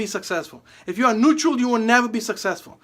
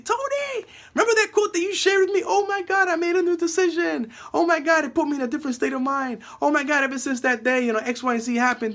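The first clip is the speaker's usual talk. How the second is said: high, excited gushing customer; giddy, much higher thank-you voice; 6 semitones higher than usual. Retold or imitated. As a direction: gush like a thrilled fan. Grateful customers gushing at Tony.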